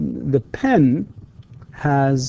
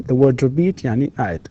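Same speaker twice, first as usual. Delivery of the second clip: ordinary delivery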